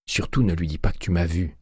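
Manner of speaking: usual speaking voice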